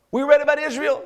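rising to a loud, fervent sermon climb; preaching. Acted. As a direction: build the sermon to a peak. Preacher building to full force.

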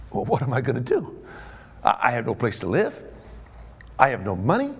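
low, subdued fretting with questioning rises; mildly worried steward. Acted. Fired steward with no place to live, mildly forlorn.

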